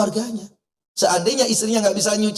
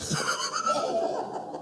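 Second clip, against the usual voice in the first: high laugh; 12 dB quieter than usual. Real, not acted. Laughs after the joke.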